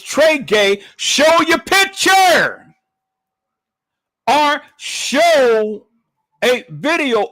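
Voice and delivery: high, loud, drawn-out challenge; emphatic demand. Retold or imitated. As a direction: call out each word slowly, high and loud. Retelling World Media's challenge.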